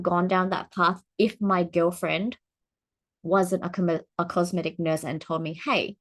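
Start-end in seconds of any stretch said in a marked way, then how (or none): none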